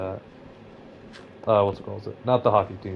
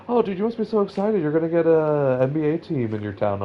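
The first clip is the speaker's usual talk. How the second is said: high-pitched, gushing mock excitement; imitating someone thrilled, pitched way up; mock excited friend; 7 semitones higher than usual. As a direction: play the overeager friend, up high.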